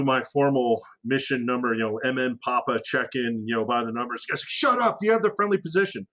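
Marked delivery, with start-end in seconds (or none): 4.5-6.1 high, fast, clipped shout under fire; urgent radio bark. Retold or imitated. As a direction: cut him off hard, no time to waste. JTAC cuts him off mid-firefight.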